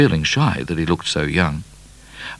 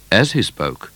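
plain reading voice

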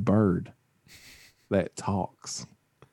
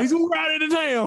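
gossiping neighbor voice. Retold or imitated. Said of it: "everybody's like".

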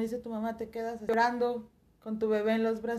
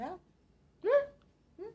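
mock puzzled; 9 semitones higher, much slower than usual. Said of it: "también fue así como de".

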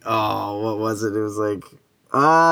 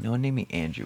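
ordinary delivery

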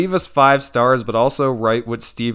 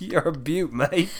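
put-on Australian accent; 4 semitones higher, 6 dB quieter, slower than usual. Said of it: "in a poor Australian accent".

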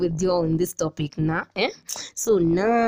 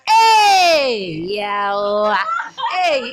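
dramatic exclamation; 6 semitones higher, 7 dB louder, much slower than usual. Real, not acted.

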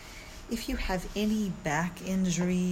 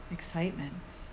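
soothing yoga teacher; 3 dB quieter than usual. Acted. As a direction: guide them calmly through the body. Leads a guided meditation.

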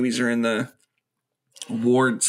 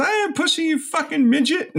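high strained mock yell at the midget; mock angry yell.

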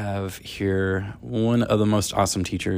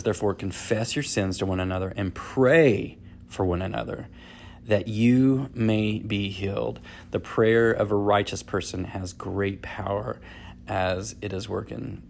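a measured, softer read of the verse; scripture reading; 5 dB quieter than usual. Acted. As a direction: read the Bible verse with quiet reverence. Reads the day's scripture aloud.

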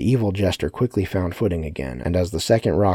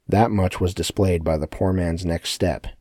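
usual speaking voice

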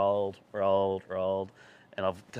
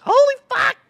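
very high, loud shriek; re-enacted horrified yell; shocked exclamation; 15 dB louder than usual. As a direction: shriek it like you've just seen the wreck. Reacting on seeing the wrecked bike.

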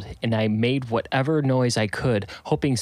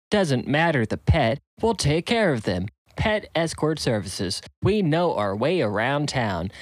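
higher, bright sponsor read; ad announcer; 4 semitones higher than usual. Acted. The phone plays a sponsor ad, announcer read. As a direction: sell it like a radio spot.

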